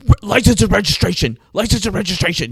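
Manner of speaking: high, loud police demand; yelling cop. Retold or imitated. Angry cop at a late-night traffic stop, yelling. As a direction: imitate an angry cop barking orders. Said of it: "He's, like, yelling".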